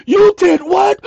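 high, loud shocked gasp; officer hears void signature, high gasp. Retold or imitated. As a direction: squeal it like she can't believe it.

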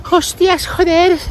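high, silly mock Spain accent; forced Spain accent. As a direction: parody Spain slang in a high voice. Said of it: "le vas a estar hablando". (acento de España fingido y agudo)

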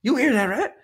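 very high put-on demanding voice; imitated loud shopper. Shopper seeking a witness from a passing stranger, high mimicked voice. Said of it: "she looks over at me and goes".

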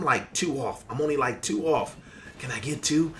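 ordinary talk, nothing marked

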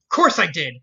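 sharp, loud snap; a sudden loud snap, defensive; snapped. Acted. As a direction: bite back hard and loud. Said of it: "Rarity snapped".

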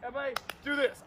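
ordinary talk

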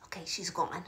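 conspiratorial whisper once she's gone. Real, not acted. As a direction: whisper so the grown-up won't hear. Adult just left the room.

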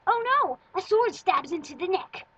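loud, high cartoon alarm; dramatic alarm. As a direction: play it like an action cartoon. Dramatic drawing story.

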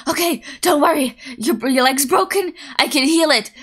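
chaotic healer. Pretend. Chaotic healer telling a patient with a broken leg not to worry, loud and high. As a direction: play a healer cheerfully blurting out false comfort. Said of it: "I wouldn't be the chaotic healer".

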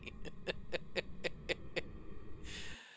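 laughing. Real, not acted.

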